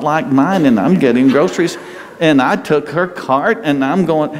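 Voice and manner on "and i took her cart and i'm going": ordinary talk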